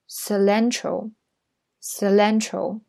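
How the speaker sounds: slow, clear cilantro, cilantro for repeating; model reading. Shown as a demonstration. Teacher leads students through cilantro, slow and clear. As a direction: read cilantro slowly for the class to echo. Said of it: "来，再跟我读三遍".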